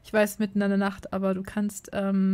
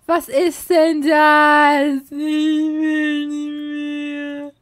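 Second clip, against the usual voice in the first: very slow, whining give-up; exasperated player moaning at the washing machine, slow and defeated; drawn-out despair; 4 semitones higher, 7 dB louder, much slower than usual. Acted. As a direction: stretch it out like you're done with life. Despairing at the game.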